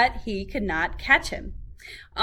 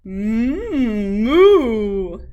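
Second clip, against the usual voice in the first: cow mooing; 9 dB louder than usual. A narrator's reading. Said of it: "said the cow".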